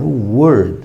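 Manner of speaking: put-on "Word" in a heavy English accent; affected English. Teacher mocking a lecturer's affected English "Word". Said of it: "o Word não estava respondendo".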